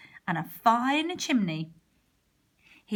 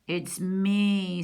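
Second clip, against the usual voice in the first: gruff voice. A narrator's reading. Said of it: "said a gruff voice".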